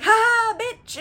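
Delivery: high, loud mocking laugh into a jeer; gloating jeer. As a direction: gloat in her face. Odysseus gloating at Circe.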